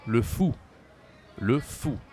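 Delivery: "le fou" loud with a rounded ou; French model word. Shown as a demonstration. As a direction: stress "fou" clearly for learners. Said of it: "So we say".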